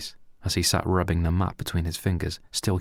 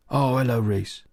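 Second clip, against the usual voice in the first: a lightly higher, softened hello; mother softening for Reese; gentle greeting; 5 semitones higher, 3 dB louder than usual. Acted. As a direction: switch to sweet for a favourite. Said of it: "Her tone had changed to a more gentile manner".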